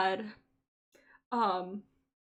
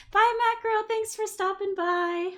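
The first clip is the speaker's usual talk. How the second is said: high, sing-out farewell; high, stretched-out bye; cheery send-off; 11 semitones higher, 4 dB louder than usual. Real, not acted. Calling goodbye to a departing viewer.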